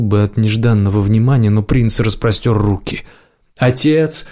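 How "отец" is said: calling out high across a hall; yelling across the room. Acted. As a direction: hail father from across the room. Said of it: "крикнул он через весь зал".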